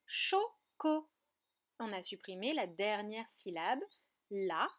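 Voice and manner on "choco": "choco" split as cho, co; two separate beats on "choco"; syllable by syllable. Shown as a demonstration. Teacher reveals what remains, split into syllables. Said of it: "il va me rester".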